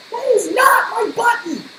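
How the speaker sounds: loud, high frustrated shout. Acted. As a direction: yell it in exasperation. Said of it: "shouted Toad".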